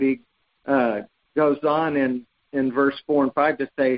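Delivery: ordinary talk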